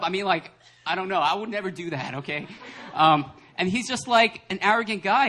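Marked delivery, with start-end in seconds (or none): none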